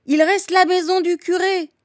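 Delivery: high, loud call over distance; shouted reply. A narrator's reading. Old woman answering from the burning village, high shout. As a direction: holler the answer back uphill.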